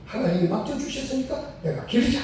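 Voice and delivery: resolute decision. Retold or imitated. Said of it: "하고 마음에 결단을 한 거예요".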